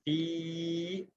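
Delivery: فی with a long, soft ee; long madd on fi. Shown as a demonstration. Teacher modelling madd for the class, drawn out. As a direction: hold the ee of فی. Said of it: "فا یا زیر".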